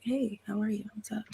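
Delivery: quiet, offhand mock cool greeting; low key cool. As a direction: toss it off like nothing matters. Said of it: "so low key and so cool".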